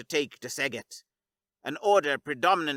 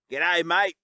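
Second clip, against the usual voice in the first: easy Australian drawl, a touch louder; calm Aussie greeting; 3 semitones higher, 6 dB louder than usual. Acted. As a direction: greet him laid-back, Australian. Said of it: "Cat said calmly".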